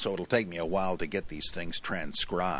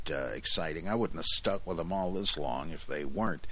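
ordinary talk, nothing marked

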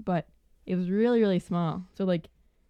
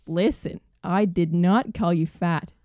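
"I did NOT call you fat", slow and louder, word by word; firm denial; 5 dB louder, much slower than usual. Retold or imitated. Employee denying it to a screaming customer, firm denial. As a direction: spell out "not" slowly and forcefully.

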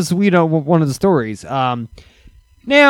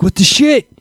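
shocked exclamation; 10 semitones higher, 4 dB louder than usual. Retold or imitated. Driver's shocked reaction retold.

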